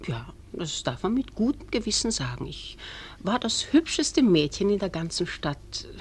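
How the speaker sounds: ordinary talk, nothing marked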